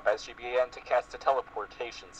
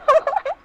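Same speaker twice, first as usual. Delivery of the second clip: very high squeal of laughter; real high-pitched cackle; shrieking laugh; 5 dB louder than usual. Real, not acted. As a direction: squeal with delight. Listener laughs at the story.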